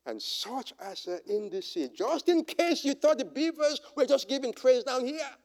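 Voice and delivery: normal talking voice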